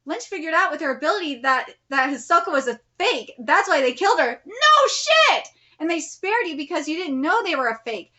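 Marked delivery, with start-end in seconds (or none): 4.4-5.5 high, loud, scornful no shit; a real burst of exasperation, pitched high; exasperated shout. Real, not acted. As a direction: bark it at the page. Reader yells at the characters.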